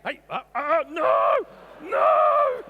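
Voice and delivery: loud, panicked "no!"; alarmed shout. Real, not acted. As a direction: yell to stop the dogs. Stopping dogs from fighting.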